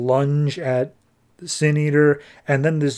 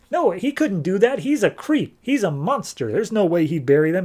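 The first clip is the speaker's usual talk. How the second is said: high-pitched mock disbelief; incredulous protest; 7 semitones higher than usual. Stranger hears Sin Eater buried his family, incredulous protest. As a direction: protest in a much higher voice, refusing to believe it.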